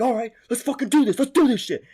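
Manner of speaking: loud, high mock rallying shout; high-pitched retold battle cry; imitated fight hype. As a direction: act out fighters squaring up, pumped.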